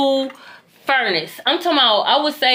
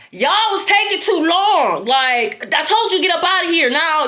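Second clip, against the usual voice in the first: higher, exasperated telling-off; scolding sisters; 6 semitones higher than usual. Retold or imitated. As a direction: snap at the sisters to hurry. Scolding sisters for being slow.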